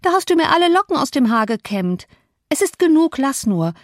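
high, peevish complaint rising on genug; irritated. Acted. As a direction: scold the maid for pulling her hair. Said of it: "fuhr sie ärgerlich fort".

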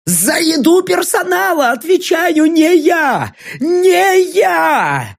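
high, desperate screaming plea; frantic yell. Acted. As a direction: shriek it out in panic, near tears. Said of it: "главный повар поспешно заорал".

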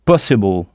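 possible with the first syllable hit hard, low; accent anglais sur po; English stress on po. Shown as a demonstration. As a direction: punch the po in possible. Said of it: "on frappait fort sur cette syllabe".

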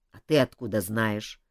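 low, grumpy question; low grumbling king. Acted. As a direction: grumble it suspiciously. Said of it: "проворчал король".

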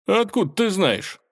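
sharp high suspicious question; wary surprise. Acted. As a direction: ask it warily, pitch jumping up. Палкович настороженно спрашивает. (настороженно, высоко)

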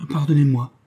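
hasty, abashed plea; flustered apology. A narrator's reading. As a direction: blurt the apology, confused. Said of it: "continua-t-il confus".

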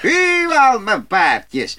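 very high, loud cry to the horses; loud call. A narrator's reading. Little Klaus cries out proudly to his horses while cracking the whip. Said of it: "knalde hij met zijn zweep en riep weer".

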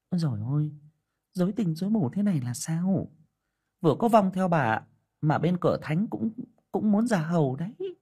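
a village sorceress fretting over what she sees; fortune teller voice. Acted.

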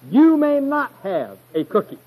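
high, emphatic mock-motherly refusal; emphatic denial. Mother turning down her little boy's request, emphatic. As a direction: deny it firmly, pitch well up. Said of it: "she wanted to emphatically deny his request".

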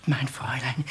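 whispered, fearful address; a frightened servant reporting a murder; hushed whisper. Acted. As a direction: drop to a whisper.

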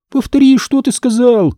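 high-pitched demand in disbelief; astonished. A narrator's reading. Hanna making her friend repeat the news, astonished. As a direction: ask as if you can't believe your ears. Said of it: "выпучила на меня глаза Ханна".

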